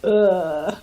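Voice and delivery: ordinary talk, nothing marked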